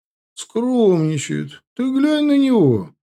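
slow high mocking tease; teasing drawl. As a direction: stretch the words, needling him. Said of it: "протянули ребята". (насмешливо тянет)

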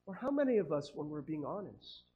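ordinary talk, nothing marked

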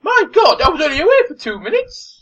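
very high, loud mock shock; horrified protest. Acted shock at the deaths.

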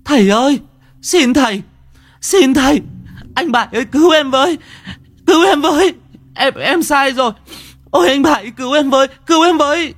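very high, desperate crying screams; frantic high-pitched wail, begging to be saved; wailing pitifully. Acted. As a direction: shriek for help through wailing sobs. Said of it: "Nó kêu khóc thảm thiết".

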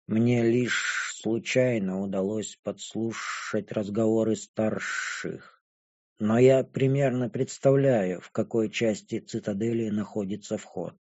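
slow, low, very soft character voice; impassive dragon girl. A narrator's reading. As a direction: share the secret quietly, taking your time.

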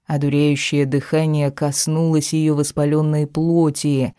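ordinary delivery, nothing marked

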